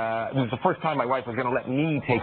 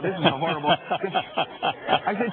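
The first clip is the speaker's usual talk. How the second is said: ordinary talk, nothing marked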